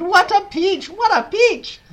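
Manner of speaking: very high, loud ecstatic cheer; Aunt Spiker shouting. A narrator's reading. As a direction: shriek with delight. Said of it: "Aunt Spiker shouted".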